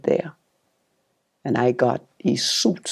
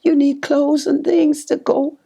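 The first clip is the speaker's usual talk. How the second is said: pitched-up lament over having nothing; high pleading prayer; 12 semitones higher than usual. Retold or imitated. Begging the Lord she has no clothes: high plea. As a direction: plead with God, voice raised high.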